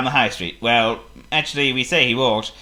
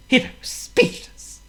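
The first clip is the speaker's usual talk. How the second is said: ordinary delivery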